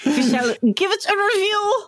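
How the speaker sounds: a playful hint of a posh Brit; a light mock-posh accent. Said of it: "we're just doing different accents today".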